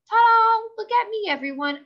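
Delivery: high, loud announcing ta-da; grand entrance. A narrator's reading. Lucille showing off her nightie to friends. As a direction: present yourself like a star.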